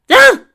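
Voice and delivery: very high, loud shriek of fright; startled scream. A narrator's reading. Twilight screams when tapped on the shoulder, a sudden shriek. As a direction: shriek as if jumping out of your skin. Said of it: "Twilight screamed".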